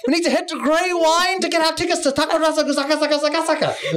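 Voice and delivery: high, grand quest voice; epic fantasy narrator. Acted. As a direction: announce it like a Tolkien quest.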